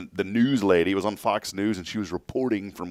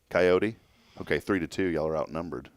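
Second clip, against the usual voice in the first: ordinary talk, nothing marked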